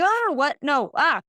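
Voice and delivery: high, loud flustered yelp; freaking out. Retold or imitated. On the phone with the institute, freaking out. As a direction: caught off guard, half-panicked. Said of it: "freaking out".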